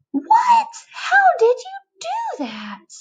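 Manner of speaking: high-pitched cry of disbelief; astonished caterpillar. Acted. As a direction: shriek in amazement at the trick. Caterpillar amazed.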